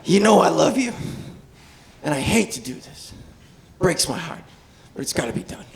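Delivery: soft, low, sorrowful boss voice; the chief sadly takes his cop's badge, quiet and tender; gentle heartbroken chief. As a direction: break it to him gently, softly and low. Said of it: "Chief's like".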